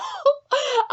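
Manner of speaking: a high, choked laugh on the edge of crying; teary laugh. Real, not acted. Creator breaking up over her character Nick, teary laugh. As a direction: laugh through sudden tears. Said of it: "I don't know why I'm getting so emotional".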